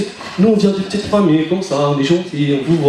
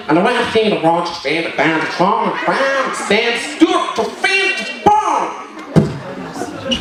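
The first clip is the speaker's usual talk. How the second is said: hammed-up English teacher impression; English teacher gibberish; 3 semitones higher, much slower than usual. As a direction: babble fake English like a lecturer. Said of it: "il parlait".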